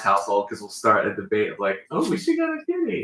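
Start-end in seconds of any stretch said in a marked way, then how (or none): 1.9-3.0 put-on high pleading kid voice; high wheedling daughter voice; daughter begging. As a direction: beg for a kitty like a child. Daughter voiced asking for cat.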